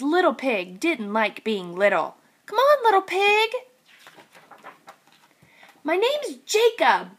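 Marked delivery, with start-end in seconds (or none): 2.5-3.5 high, bright sibling voice; raised pitch calling little brother; older sibling. Acted. Sibling voice in read-aloud. 5.8-7.1 a touch higher, small piglet voice; lightly voiced little pig protesting; lightly raised little pig voice. Little Pig's voice.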